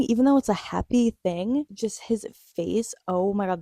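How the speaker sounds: ordinary delivery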